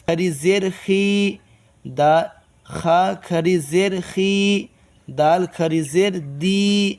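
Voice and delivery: خی and دی held long and slow; drawn-out long vowels. Shown as a demonstration. Teacher shows students how to stretch it. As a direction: stretch each final vowel steadily. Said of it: "ایسے ہی پڑھنا ہے کھینچ کھینچ کے".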